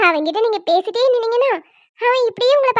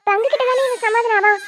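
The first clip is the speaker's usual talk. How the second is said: ordinary talk, nothing marked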